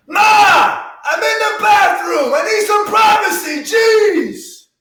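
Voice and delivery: high, loud yell from the bathroom; shouting. Acted. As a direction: holler it through the door. Yelling to mom from the bathroom.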